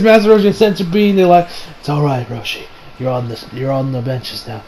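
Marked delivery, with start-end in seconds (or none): 1.8-4.6 low, soft, retold reassurance; quiet consoling. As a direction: speak gently and low to the benched man. Voicing comfort to benched Roshi.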